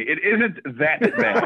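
mock-proper, laughing through it; mock-proper correction. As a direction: correct yourself in hammed-up proper English. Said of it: "okay, excuse me".

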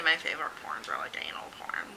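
hushed confessional whisper. Real, not acted. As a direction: whisper it like a secret. Confessing a taboo favourite.

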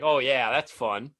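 slow, dry, mocking "oh yeah that's fun"; sarcastic mock enthusiasm. Mocking the network's choice of a boring game, sarcastically.

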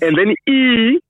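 letter E said high and rising; spelling it out. Shown as a demonstration. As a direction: call out each letter. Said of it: "Can you spell it".

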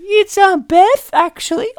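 high, loud put-on secretary voice; mock falsetto assistant; secretary correcting boss.

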